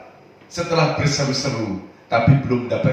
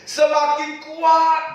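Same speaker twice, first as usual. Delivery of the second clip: high, loud and slow; preacher stressing growing strength; building louder; 13 semitones higher, 4 dB louder, much slower than usual. Real, not acted. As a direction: preach it out with rising force. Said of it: "semakin keras".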